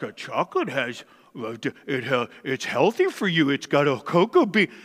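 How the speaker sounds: low, muddled mock voice, stumbling over the words; mocking health-food excuse. Mimicking people who defend chocolate as healthy, mocking low voice. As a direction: parody a dopey know-it-all making excuses. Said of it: "they're like".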